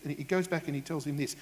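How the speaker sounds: ordinary delivery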